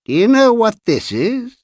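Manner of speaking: put-on very high, eerie old man's question; cracked-bell voice. A narrator's reading. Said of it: "in a voice like a small, cracked bell".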